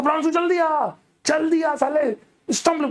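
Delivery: पुकारते हुए ऊँची, ज़ोरदार आवाज़ में दोस्त को बुलाना; घबराकर दोस्त का नाम ऊँचे सुर में पुकारो; calling out. Retold or imitated. Calling friend to bring stump. (कमरे के पार दोस्त को ज़ोर से आवाज़ देना)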